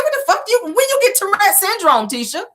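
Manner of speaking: very high, stunned cry; shocked outburst. Retold or imitated. Vlogger reacting to Tisha's twitchy flirting, stunned. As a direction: gasp it out in disbelief. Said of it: "I said".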